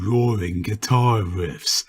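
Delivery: ordinary delivery, nothing marked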